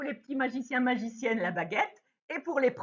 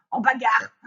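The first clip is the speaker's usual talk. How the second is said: louder word breaking into a laugh; 6 dB louder than usual. Real, not acted. Teacher joking that it ends in a fight, laughing. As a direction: laugh it off lightly.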